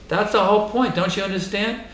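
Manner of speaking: ordinary talk, nothing marked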